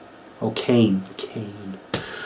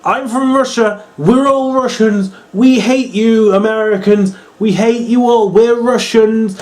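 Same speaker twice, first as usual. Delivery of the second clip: mock Russian villain; 8 semitones higher, 3 dB louder than usual. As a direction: sneer it at the American crowd. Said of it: "So she's like".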